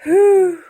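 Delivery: loud high relieved whew; sigh of relief. Acted. Elsie relieved as the mammoth lands. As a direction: let out relief. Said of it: "she sighed".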